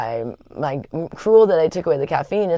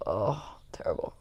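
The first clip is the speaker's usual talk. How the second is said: soft whispered moan; whispered groan; 8 semitones lower, 13 dB quieter than usual. Real, not acted. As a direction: whisper it as if still feeling sick. Recalling a bad hangover day.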